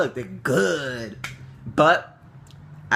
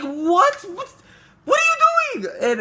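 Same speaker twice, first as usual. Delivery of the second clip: shocked disbelief; 7 dB louder, slower than usual. Retold or imitated. Officer takes phone as evidence.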